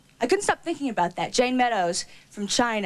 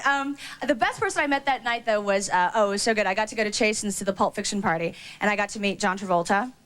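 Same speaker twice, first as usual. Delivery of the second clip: usual speaking voice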